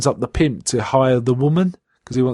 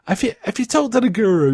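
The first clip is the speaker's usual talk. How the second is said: mock boss voice; 9 semitones higher than usual. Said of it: "He goes".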